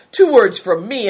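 pointed contempt; scornful. A narrator's reading. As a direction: warn him with scorn. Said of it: "said Green Fortune scornfully".

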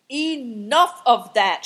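slow, loud, high shout; angry shout. A narrator's reading. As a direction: shout to cut them off, drawing it out. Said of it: "shouted the man".

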